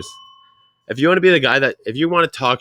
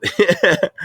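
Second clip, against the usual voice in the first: short laugh; 5 semitones higher than usual. Real, not acted. Sister's photo backdrop joke.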